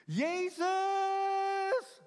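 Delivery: high, loud, long-held call for Jesus; desperate cry. Acted. Preacher acting the man crying out to Jesus, desperate cry. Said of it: "en hij riep".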